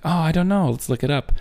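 put-on high-pitched, playful take on an Israeli accent; Gal Gadot's sexy accent. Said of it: "she's got that sexy accent".

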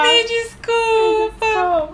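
mock sobbing apology. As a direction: wail the apology like a crying teen. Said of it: "começam a chorar".

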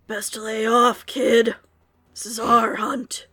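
slow, lightly voiced gruff veteran; a hint of a grizzled old soldier; old war veteran voice. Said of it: "this very deep voice, this elderly man's voice, almost like a war veteran".

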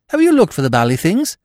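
quick, pushy and upbeat; brisk eager question. Acted. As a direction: rattle it off briskly. Freddy presses quickly.

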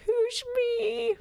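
very high falsetto granny question; fake grandmother voice. Wolf disguised as grandma questioning the girl, falsetto.

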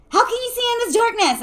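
high, loud questioning; incredulous. A narrator's reading. Lucy doubting Jessica's sighting in darkness, incredulous.

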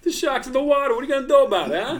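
high, laughing wiseguy dare; hammed-up tough-guy taunt; mock mobster challenge. Challenging friend, laughing mock-mobster voice.

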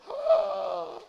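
slow question then a high horrified cry; mock offended shock. Swiss man asked if he's French, cries out in mock horror. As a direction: play the Swiss man's horror at being called French.

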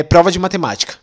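ordinary talk

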